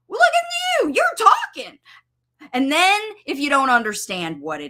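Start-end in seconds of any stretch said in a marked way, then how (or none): 0.1-1.8 high, bright celebration of the try; delighted parent praise; celebrating. Shown as a demonstration. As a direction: cheer the child on with big praise. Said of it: "call it out, and celebrate it".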